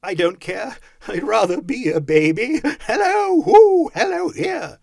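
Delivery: high, carefree babble building to loud whoops; childlike elation; giddy Scrooge. A narrator's reading. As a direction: let it grow into a gleeful holler. Giddy Scrooge exclaiming, ends whooping.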